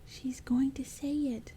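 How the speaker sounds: high, slow goblin whisper; high creepy goblin whisper. Acted. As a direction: hiss it eagerly like a little goblin.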